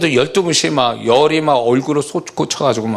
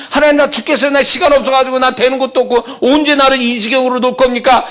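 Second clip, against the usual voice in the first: mildly voiced cry to God, higher and louder; a hammed-up moan of despair to God; desperate believer; 8 semitones higher, 3 dB louder than usual. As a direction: worshipper wailing to God, lightly acted.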